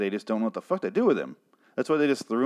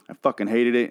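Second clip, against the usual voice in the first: ordinary delivery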